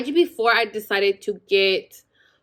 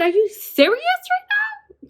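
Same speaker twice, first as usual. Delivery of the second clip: very high, strained incredulous squeal; shocked disbelief. Retold or imitated. Told she's only four centimeters, high incredulous squeal. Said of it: "I said".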